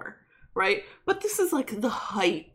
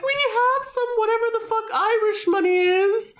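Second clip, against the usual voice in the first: much higher, small pleading voice, hammed up; squeaky mock plea, cap in hand; mock timid begging; 3 dB louder than usual. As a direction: play a meek band begging for songs. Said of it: "And they're like".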